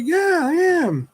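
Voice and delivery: high, drawn-out parent voice; insisting to a child. Retold or imitated. Dad contradicting young daughter, high drawn-out insistence.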